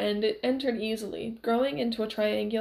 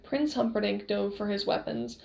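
plain reading voice

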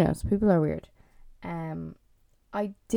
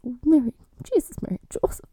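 pious exclamation; 6 semitones higher than usual. Retold or imitated.

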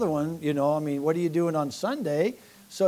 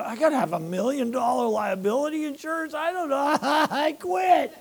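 higher voice, hammed-up shock at the price; mock panicked disbelief; 9 semitones higher than usual. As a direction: play the overwhelmed newcomer, laughing at yourself. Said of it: "you go".

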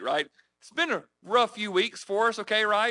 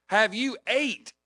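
slow, raised mock yell; drawn-out, higher retold yell; imitated yelling; 4 semitones higher, slower than usual. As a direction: imitate a coworker calling over noise. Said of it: "he's yelling at the top of his lungs".

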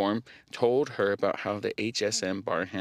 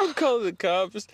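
mock threat; 9 semitones higher, 3 dB louder than usual. Mock threat amid shared laughing fit.

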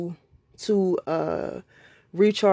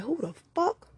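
muttered indignation; 6 semitones higher, 7 dB quieter than usual. Retold or imitated. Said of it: "You thinking like".

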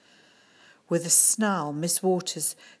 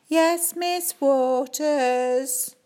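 slow, higher sing-song chorus; class chorus; 8 semitones higher, 5 dB louder, much slower than usual. Acted. Children answer the teacher together, sing-song chorus. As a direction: chant it like a whole class. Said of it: "everyone chorused".

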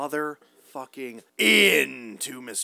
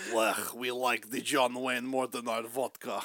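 mock Russian accent, a bit lower and softer; a heavy joking Russian accent; 4 semitones lower, 5 dB quieter than usual. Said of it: "Okay, that was".

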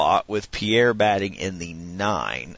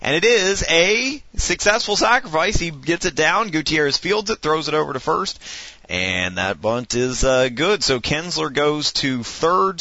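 lively sportscaster calling the action; play-by-play announcer; 5 semitones higher than usual. Acted. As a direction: call it like a radio broadcaster. Announces the simulated game.

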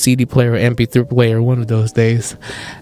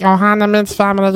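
Stitch voice; 5 semitones higher, faster than usual. Retold or imitated. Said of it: "I would sound like Stitch".

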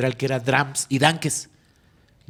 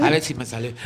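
normal talking voice

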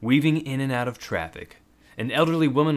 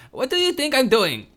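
shrill furious yell, much higher and louder; screaming back; 8 dB louder than usual. Acted. Gunwoman screaming back at the bald man, furious. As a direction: scream it right back at him. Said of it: "the woman screamed back".

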